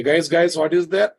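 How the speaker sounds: ordinary talk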